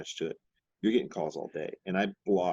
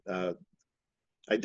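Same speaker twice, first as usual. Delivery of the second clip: usual speaking voice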